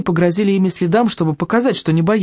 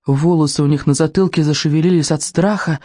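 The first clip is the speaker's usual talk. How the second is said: usual speaking voice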